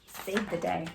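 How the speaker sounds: ordinary delivery, nothing marked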